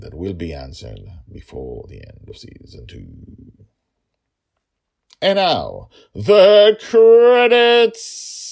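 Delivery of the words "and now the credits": very slow, high, loud announcement; announcer flourish. Acted. Host announcing the credits, slow, high and loud. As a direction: stretch it out grandly.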